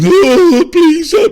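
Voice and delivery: high, loud wailing plea; hammed-up crybaby wail; put-on sobbing cry for help; mock crying. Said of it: "Did you see how my girl last week crying?".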